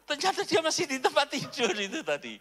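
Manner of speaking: high, loud, laughing through it; laughing punchline. Real, not acted. Preacher revealing it was a dream, laughing. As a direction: crack up at your own joke.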